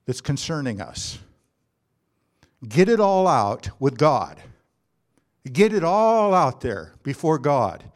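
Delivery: ordinary talk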